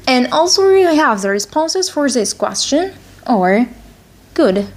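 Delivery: ordinary talk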